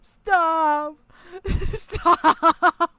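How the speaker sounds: shrieking high and loud no through laughter; laughing scream of no; hysterical laughing protest. Real, not acted. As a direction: beg her to stop while laughing. Hysterical at wife's joke.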